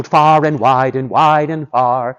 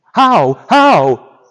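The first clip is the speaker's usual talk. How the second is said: raised, insistent questioning; eager demand; 5 semitones higher, 4 dB louder than usual. Acted. Boots begs to learn how to break spell, urgent. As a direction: press urgently for the answer.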